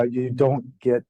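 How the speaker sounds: normal talking voice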